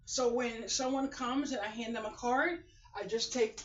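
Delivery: ordinary talk, nothing marked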